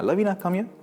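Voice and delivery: high call across the room. Retold or imitated. Wife calling her cousin to take the baby, high eager call. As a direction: call her over, pitched well up.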